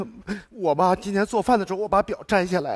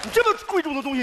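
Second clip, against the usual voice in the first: high-pitched, indignant reproach; exasperated telling-off; angry scolding; 7 semitones higher than usual. Acted. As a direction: scold them for a reckless prank. Acted scolding over a prank.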